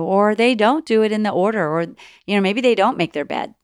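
ordinary delivery

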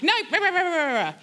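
high-pitched rah-rah tirade, falling away; hammed-up bickering that starts high; mock confrontational rant. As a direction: act out a pretend argument. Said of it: "I can be confrontational".

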